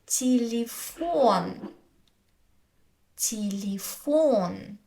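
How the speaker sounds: "телефон" slowly, first vowels as ee, stress on фон; unstressed е as и. Shown as a demonstration. Teacher giving an example word, "телефон" slowly with ee for е. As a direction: draw out "телефон" as tee-lee-FON. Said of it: "it's pronounced as и".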